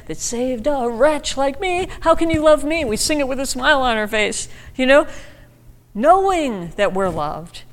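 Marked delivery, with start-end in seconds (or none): 0.1-2.8 mock plea. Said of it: "Then we say".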